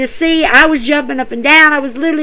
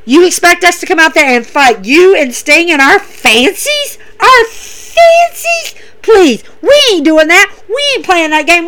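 retold trash talk, pitched up in disbelief; mock indignant trash talk; 5 semitones higher than usual. Page and Sky mouthing off at Darby and Sting, mock indignant. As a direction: imitate mouthy rivals scoffing, "fancies" stretched high. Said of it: "start running their mouth and saying how".

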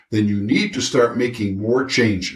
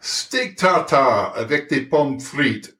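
French chef. Acted. French food fantasy.

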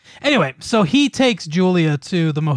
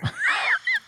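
high burst of laughter; 7 dB quieter than usual. Real, not acted. Laughs at cohost joke.

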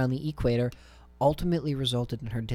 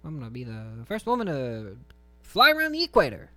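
imagined twenties voice. Acted. Aviator declaring her big goal, mock twenties voice. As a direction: play an imagined woman from the twenties. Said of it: "this is what I imagine they sounded like".

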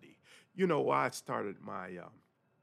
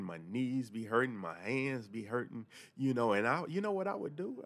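ordinary delivery

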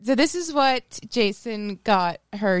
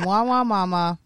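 mock whining. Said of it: "That's what I'm called all the time".

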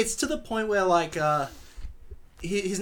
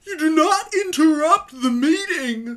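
much higher, louder put-on villain voice; pinched and high, a pompous rich boy laying down the law; impersonating the antagonist; 14 semitones higher, 10 dB louder than usual. Friend mimics the movie antagonist's line, high and loud. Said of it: "give us actual dialogue".

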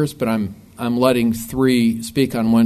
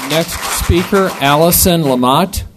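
calling out a name; 5 semitones higher, 5 dB louder than usual. Real, not acted. Chair calls next speaker across room.

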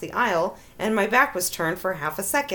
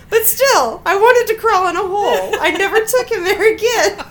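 laughing; 8 semitones higher, 6 dB louder than usual. Real, not acted. Laughing through embarrassment.

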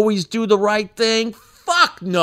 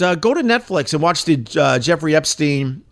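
ordinary talk

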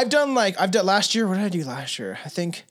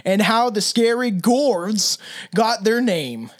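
slow, drawn-out campfire-tale read; lightly hammed spooky storyteller; spooky story narration; slower than usual. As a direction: tell it like a ghost story, slowly.

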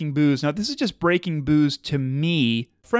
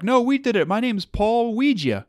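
mock rival voice; 7 semitones higher than usual. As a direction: play a smug credit-stealer. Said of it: "and they're like".

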